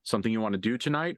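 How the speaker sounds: usual speaking voice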